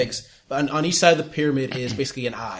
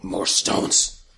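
deep monster growl of hunger; low-pitched creature demand; 7 semitones lower than usual. Acted. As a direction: rumble it low as a hungry beast.

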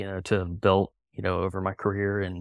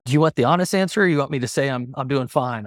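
retold frank challenge; 5 semitones higher, 4 dB louder, faster than usual.

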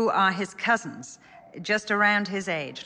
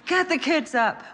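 urgent parent; 10 semitones higher, 5 dB louder than usual. Retold or imitated. Parent rousing family at night.

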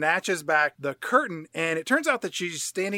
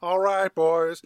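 put-on slow country old-timer; mock Southern patriarch, drawing out the words; Uncle Jesse; slower than usual. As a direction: take your time with a country drawl. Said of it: "Uncle Jesse wanders up and he says".

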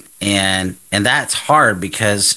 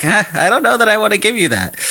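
much higher playful voice of God, teasing refusal; mock God refusing; 13 semitones higher, faster than usual. Author imagining God's reply about the book contract, high playful mock refusal. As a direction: hold the gift back with a high teasing huh. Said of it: "the Lord was like".

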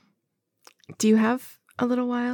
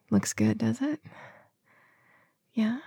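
soft intimate whisper; 2 semitones higher, 4 dB quieter than usual. Real, not acted.

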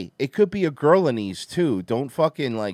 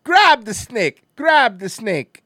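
mock Jamaican accent; 2 semitones higher, 6 dB louder than usual. Mimicking the snake catchers, loud Jamaican accent. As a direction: call it out in put-on Jamaican. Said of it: "doing a Jamaican accent".

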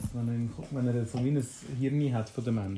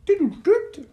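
robot beep; 7 dB louder, slower than usual. Acted. Robot without speech beeps.

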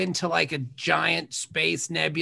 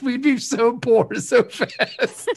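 laughing, slow; 6 semitones higher, much slower than usual. Real, not acted. Laughing after bounty joke.

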